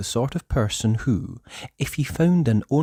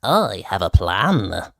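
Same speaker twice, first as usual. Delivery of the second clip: grinning plotter; 3 semitones lower, 3 dB louder, slower than usual. Acted. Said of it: "now grinning".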